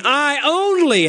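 "I only" drawn out with emphatic weight; stress on I ONLY. Shown as a demonstration. Preacher showing Paul's Greek emphasis, stress on "I only". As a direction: lean hard on "I only". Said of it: "emphatic in the Greek".

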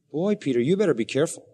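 normal talking voice

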